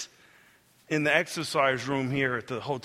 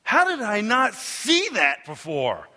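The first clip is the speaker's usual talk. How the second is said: slow, high, disbelieving exclaim; shocked; 6 semitones higher, 4 dB louder, slower than usual. Retold or imitated. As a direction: gasp it out in disbelief at what you're seeing. I was like.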